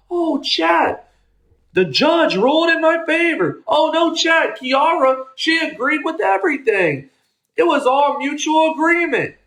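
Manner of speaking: high mocking whine of a crying streamer; mocking whiny streamer. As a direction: mimic them complaining to chat. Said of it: "To sit there and say".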